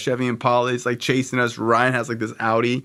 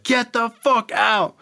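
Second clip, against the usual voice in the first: imitated screaming; 13 semitones higher, slower than usual. Friend orders him out of the car, mock screaming. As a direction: mimic a panicked driver's scream. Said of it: "he's screaming".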